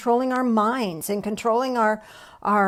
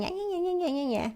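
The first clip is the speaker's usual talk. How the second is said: mock nagging yeah yeah yeah, pitched higher and softer; voice of negativity bias; 8 semitones higher, 6 dB quieter than usual. Preacher voicing the negativity bias, dismissive. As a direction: tease the inner naysayer. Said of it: "That's that negativity bias going".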